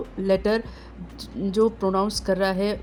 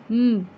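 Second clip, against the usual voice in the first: long closed-lip hum; humming m sound; 4 dB louder than usual. Shown as a demonstration. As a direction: hum through shut lips to show the M. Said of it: "उसकी आवाज़ सुनिए".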